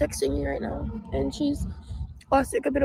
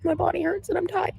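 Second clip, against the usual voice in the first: whiny tired voice; 6 semitones higher than usual. Real, not acted. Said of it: "I love the fake cry out".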